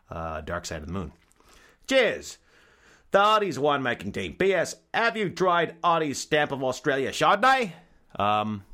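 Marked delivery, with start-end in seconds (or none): 1.9-7.7 louder, higher hammed-up Australian sign-off; cheery Australian blurb sign-off; mock Aussie accent. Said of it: "Very Aussie".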